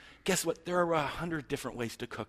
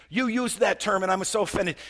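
high, louder hammed-up offended voice; mock offense; 7 semitones higher, 6 dB louder than usual. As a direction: act wounded over nothing. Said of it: "And it's like".

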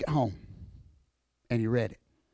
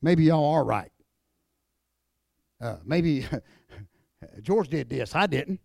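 mock Jesus giving in; 3 semitones lower than usual. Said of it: "he'd have said".

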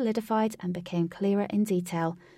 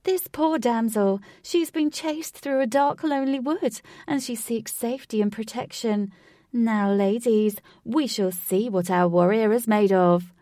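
eccentric showman voice; 3 semitones higher, 5 dB louder than usual. Acted. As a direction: ham it up like a game show host. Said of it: "in his eccentric voice".

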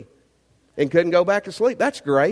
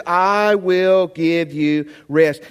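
slow, weighty I will give you rest; emphatic promise; 4 dB louder, much slower than usual. Acted. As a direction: promise it slowly. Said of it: "And he says".

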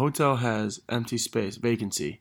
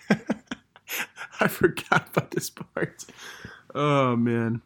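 embarrassed laughing; 3 semitones higher, slower than usual. Real, not acted. Embarrassed rereading old messages.

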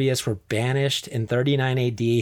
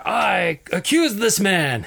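slow, put-on, theatrical accuser; hammed-up courtroom accusation, slow and deliberate; dramatic accusation; 5 semitones higher, slower than usual. Agrippa accusing his uncle before the emperor, slow and theatrical. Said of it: "in dramatic effect".

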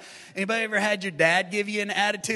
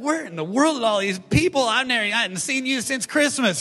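loud, higher, put-out churchgoer; mock indignant regular; 4 semitones higher, 7 dB louder than usual. Regular churchgoer griping about Easter crowds, loud mock indignation. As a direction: ham up the offended regular. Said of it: "you're gonna be like".

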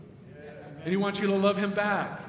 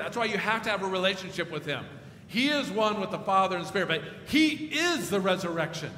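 usual speaking voice

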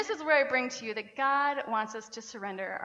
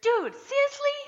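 put-on high squeaky little-kid voice, much higher; toddler imitation; 6 dB louder than usual. Mom imitating her little daughter Abby copying dad, squeaky and high. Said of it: "from the back seat we hear".

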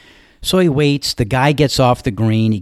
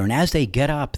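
usual speaking voice

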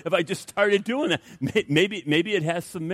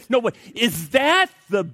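higher, louder, slow disbelieving jab; incredulous scoff; 3 semitones higher, 3 dB louder than usual. Retold or imitated. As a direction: scoff at the weak methods. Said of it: "I look at this and I say".

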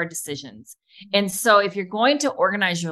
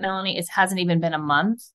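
ordinary talk, nothing marked

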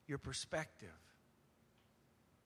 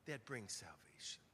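whisper; 9 semitones lower, 14 dB quieter than usual. Acted. Preacher drops to whisper.

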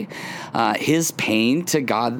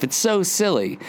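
ordinary talk

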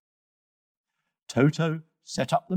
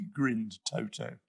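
ordinary delivery

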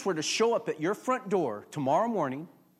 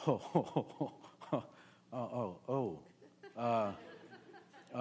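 imitating a quarterback laughing it off; oh-oh-oh broken by a laugh; cracking up; 5 semitones lower, 7 dB quieter than usual. Quarterback relieved at the pastor's joke, laughing. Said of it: "he started cracking up".

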